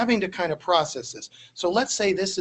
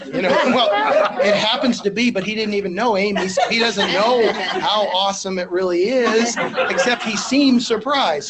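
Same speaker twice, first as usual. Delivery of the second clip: laughing; 3 semitones higher, 6 dB louder than usual. Real, not acted. Laughing about wife Amy.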